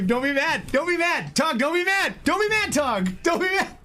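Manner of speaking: high sing-song coaxing; playful pleading. Real, not acted. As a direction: coax him out of sulking. Coaxing friend Tog after teasing.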